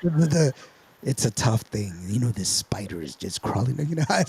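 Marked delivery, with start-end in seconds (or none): 1.0-3.8 movie narrator. Pretend. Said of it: "I'm gonna make a movie".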